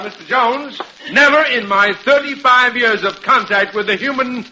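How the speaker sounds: high, pompous confrontation; declaiming a rebuke. Acted. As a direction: launch grandly into the prepared tirade. Delivers the rehearsed speech to confront Jones.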